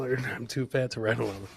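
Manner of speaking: ordinary talk, nothing marked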